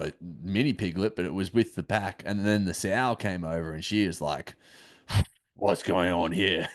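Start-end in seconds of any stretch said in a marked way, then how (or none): 5.6-6.7 angry sow. Retold or imitated. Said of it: "the noises she was making".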